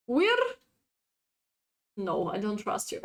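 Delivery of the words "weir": "Weir" tried with a high rising question; "Weir?" as a higher, tentative try; questioning pronunciation. Shown as a demonstration. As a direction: sound out "Weir" doubtfully, pitched up. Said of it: "You just write down weir".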